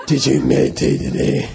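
put-on voice of a mean lama. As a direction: imitate the stern lama checking up on a monk. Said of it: "His voice was like that".

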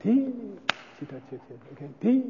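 quiet, understated syllables; soft murmur. Shown as a demonstration. Teacher demonstrating the whispered debate opener, softly. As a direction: keep it barely audible. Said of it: "But it's like".

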